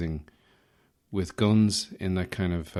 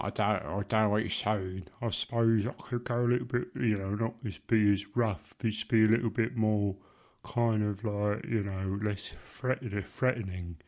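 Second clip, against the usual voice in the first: put-on rough English voice; mock English geezer accent. Partner objects to the voices, reply stays in a mock English accent. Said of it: "Don't talk in those English voices".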